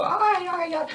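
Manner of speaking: motor-mouth neighbor cutting in; super-fast talker. Retold or imitated. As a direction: rattle it off in a tiny high voice. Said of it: "This woman talks super fast".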